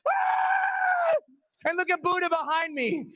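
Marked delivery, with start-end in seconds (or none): 0.1-1.2 very high, sustained mock scream; falling scream. Skydiver thrown out of the plane, long high scream. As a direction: let out a long piercing acted scream. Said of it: "And I'm screaming out the".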